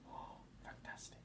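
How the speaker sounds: soft whispered aside. Real, not acted. Aside to child.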